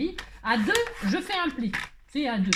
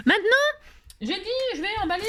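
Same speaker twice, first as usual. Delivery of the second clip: high, loud announcement; calling out; 9 semitones higher, 4 dB louder than usual. Pretend. Crafter announcing the next step to viewers, calling out high. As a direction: burst out high, opening loud on the first word.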